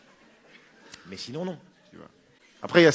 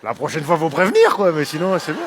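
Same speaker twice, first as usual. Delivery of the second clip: giddy surprised voice; 5 semitones higher, 10 dB louder than usual. Acted. Homophobe surprised enjoying it.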